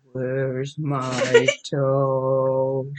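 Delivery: low, very slow groan; low creeping monster groan; groaning creature voice. A narrator's reading. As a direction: sink it into a low, drawn-out moan. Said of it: "the voice groaned".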